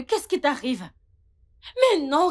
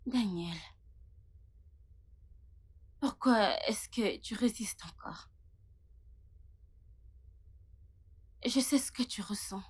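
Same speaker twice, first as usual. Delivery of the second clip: taunting whisper; 5 semitones lower, 12 dB quieter than usual. Acted. Tamara whispering to Daniel to stop resisting. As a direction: whisper it like a secret meant to needle him.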